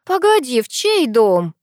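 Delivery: high, loud start of disbelief; surprised. A narrator's reading. Sister stops her over the house plan, surprised. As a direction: jump up in pitch, taken aback. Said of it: "удивилась Ангелина".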